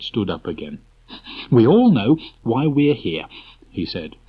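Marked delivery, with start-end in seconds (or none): 1.5-3.3 brisk chairman Rabbit. Acted. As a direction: call the meeting to order. Rabbit opens the meeting.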